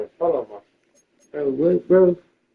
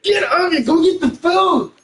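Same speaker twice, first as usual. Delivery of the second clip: yelling; 11 semitones higher than usual. Real, not acted. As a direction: yell him out the door, pitched up. Yelling at sibling to leave.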